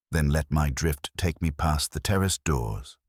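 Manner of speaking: usual speaking voice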